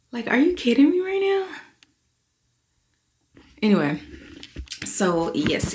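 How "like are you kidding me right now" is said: high, loud delight; excited. Real, not acted. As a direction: squeal it with joy. Delighted exclaim.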